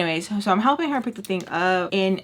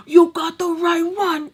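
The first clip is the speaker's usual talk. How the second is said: high, louder furious threat; pissed shout; 9 semitones higher, 5 dB louder than usual. Retold or imitated. Tommy yelling the warning louder, high furious threat. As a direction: let it explode at them. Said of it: "just pissed".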